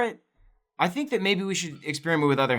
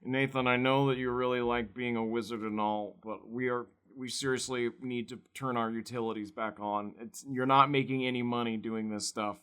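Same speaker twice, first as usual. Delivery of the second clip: gloomy roommate voice; 3 semitones lower, 11 dB quieter than usual. Acted. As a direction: mumble like a nagging downer. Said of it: "He's like".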